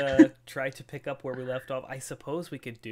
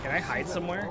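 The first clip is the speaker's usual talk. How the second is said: usual speaking voice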